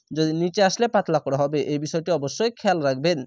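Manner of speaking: usual speaking voice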